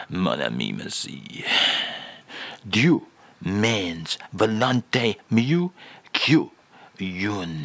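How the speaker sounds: very low "Mon ami, merci", then a slow mock French phrase; comic French reading with a deep start; fake French phrase. Shown as a demonstration. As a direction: read "Dieu means volonté mieux" slowly in a hammed-up French accent. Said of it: "with your actual French filling".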